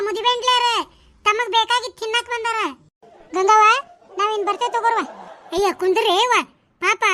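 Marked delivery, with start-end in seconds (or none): 3.4-3.6 calling out. Acted. Calling out to Gangavva.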